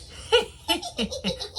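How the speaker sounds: high-pitched little giggle; laughing. Real, not acted. Recapper amused at being own witch cat, giggling.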